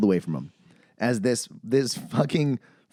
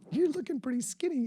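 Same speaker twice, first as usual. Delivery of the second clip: mock diner line; 13 semitones higher, 8 dB quieter than usual. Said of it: "quoting the diner line".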